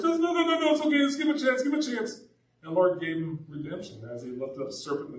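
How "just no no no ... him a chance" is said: high, pleading Moses, no no no. Retold or imitated. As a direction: beg God to hold off, voice raised high. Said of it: "Moses like".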